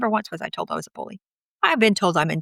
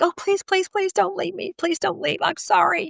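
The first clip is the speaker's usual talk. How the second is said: high, pleading mock begging; 12 semitones higher than usual. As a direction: imitate her own desperate pleading, high. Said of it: "And then I'd be like".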